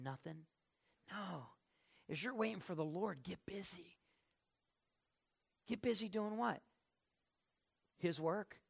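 ordinary talk, nothing marked

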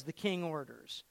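normal talking voice

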